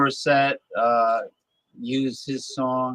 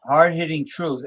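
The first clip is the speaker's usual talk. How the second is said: usual speaking voice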